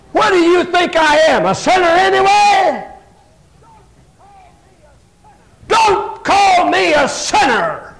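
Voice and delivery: high, loud, offended outburst; indignant Pharisee. Acted. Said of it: "He got an attitude".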